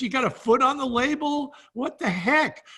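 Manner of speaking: an imitated buyer who can't believe what he sees; incredulous buyer. Buyer scoffing at the label.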